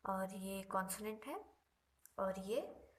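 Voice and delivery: usual speaking voice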